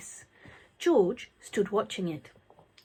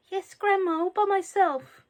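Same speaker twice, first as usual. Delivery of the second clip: put-on boy's voice; 11 semitones higher than usual. A narrator's reading. George answers Grandma.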